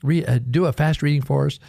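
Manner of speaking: lightly mimicked children's request; pleading schoolkids. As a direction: voice eager pupils begging the teacher. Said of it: "the kids just loved it. And they always".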